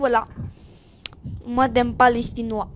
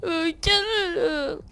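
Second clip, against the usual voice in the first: mock whine; 4 semitones higher, slower than usual. Mock crying plea.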